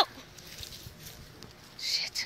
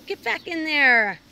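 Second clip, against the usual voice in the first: shouting; 4 semitones higher, 13 dB louder, slower than usual. Real, not acted. Bird stuck, shouting at it.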